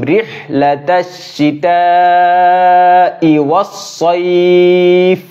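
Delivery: slow melodic chant of the verse; tajweed recitation; Quran recitation. Acted. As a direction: sing out the verse, long and held.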